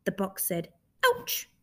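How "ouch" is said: very high, short yelp of pain; startled ouch. Acted. Hidden creature poked through the box, high startled ouch.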